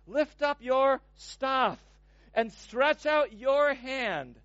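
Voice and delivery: slow, deliberate verse reading. Shown as a demonstration. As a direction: read each word slowly for weight. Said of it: "Notice how he words this".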